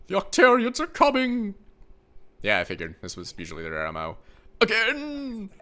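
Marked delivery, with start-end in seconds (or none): 0.1-1.5 high, loud panicked cry; panicked shout. Acted. Voicing panicked character. 4.6-5.5 put-on old man exclaiming.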